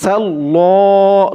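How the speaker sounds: সাল্লাহ drawn out with rounded "lo"; heavy "lo". Shown as a demonstration. Teacher isolating the syllable, সাল্লাহ with long rounded "lo". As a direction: stretch সাল্লাহ into a heavy "sal-lo". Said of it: "ল বলতে হবে".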